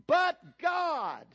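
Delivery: high, loud cry of \"But God\"; preacher's shout. Real, not acted. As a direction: hit it hard as the turning point. Climax of the scripture reading.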